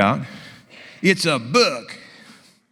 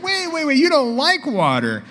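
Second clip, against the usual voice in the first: much higher, retold disbelief; surprised; 10 semitones higher than usual. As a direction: act amazed at the news. Doctor reacts to surprise in story.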